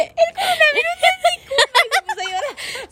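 high wailing through sobs and laughter; mock sobbing wail. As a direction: wail it like a crying kid, half laughing. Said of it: "Y la Karen de que". (llanto exagerado, agudo y entre risas)

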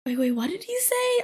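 high-pitched baffled coworker imitation; confused. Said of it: "is so confused".

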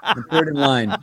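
talking through real laughter; laughing. Real, not acted. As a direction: laugh while you answer. Laughing through his reply.

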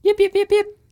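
high yapping little dog; yappy panting puppy. Pretend. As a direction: yip like a tiny excited puppy. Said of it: "Ty is barking dog".

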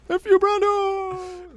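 high, slow hammed-up yell; mock shout. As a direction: yell it in a playful outburst. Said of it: "Like that".

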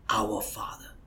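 soft unvoiced whisper of our Father. Shown as a demonstration. Quoting how Jesus begins the prayer, hushed whisper. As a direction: breathe the words our Father reverently. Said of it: "He starts with".

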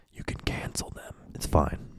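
voiceless secret whisper. Real, not acted. Confiding aside to listeners.

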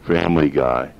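ordinary talk

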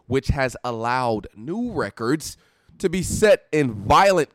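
slow, drawn-out reading; slow dramatic reading. Shown as a demonstration. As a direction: read it out slowly, letting it hang. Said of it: "that's why I'm reading it like this".

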